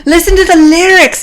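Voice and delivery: high, loud mock scream over the music; retold screaming. Irish dude screams song meaning at her, loud mock yell. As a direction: bellow it like a drunk punk fan. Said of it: "he's screaming".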